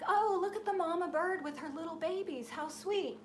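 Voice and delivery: charmed cooing. Retold or imitated. Dreamer cooing at the nest, charmed. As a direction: coo at the mama bird's babies. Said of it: "and I'm like".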